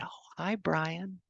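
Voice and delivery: soft, high, barely there; lightly voiced dying grandma, hushed and high; faint grandma voice. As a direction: imitate a frail grandma's fond hello, soft and high. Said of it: "she smiled and she said".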